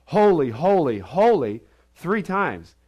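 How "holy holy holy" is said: loud, high ringing holy, holy, holy; angelic proclamation. Retold or imitated. Preacher voicing the angels to the congregation, loud and ringing. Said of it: "the angels are saying".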